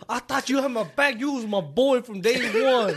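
loud, high betrayed homeboy; hammed-up street outrage, half laughing; mock gangster. Dad accuses his boy of betrayal, mock gangster. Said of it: "Get all gangsta up, like".

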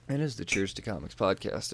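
ordinary talk

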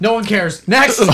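impatient dismissal. Real, not acted. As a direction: cut them off and move things along. Cuts friend off impatiently.

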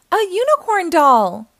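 high, loud delighted shout; boy yelling at his present; excited yell. A narrator's reading. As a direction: burst out with joy. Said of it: "he yelled".

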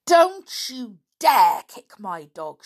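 slow, loud furious shout. A narrator's reading. George defending her dog from Lou, slow furious shout. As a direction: bite off each word in a rage. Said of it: "she shouted".

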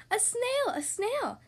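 high, startled exclamation; excited alarm. A narrator's reading. Class spotting a snail in school, exclaiming excitedly. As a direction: call out a sudden sighting.

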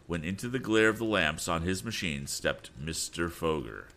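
ordinary delivery, nothing marked

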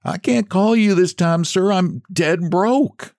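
high, slow quavering plea; little man confessing to the hermit, quavering. A narrator's reading. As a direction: quaver it, scared and broke.

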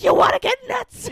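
high, loud Joker yell; Joker shout. Retold or imitated. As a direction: belt it out like the Joker. Joker's big line re-enacted.

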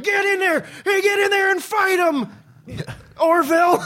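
high-pitched shout; mock coach yell. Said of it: "he's like".

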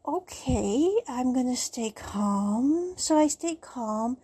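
ordinary talk

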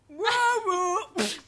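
squeaky high zombie woof. Retold or imitated. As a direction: bark like a toy zombie. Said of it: "it would go".

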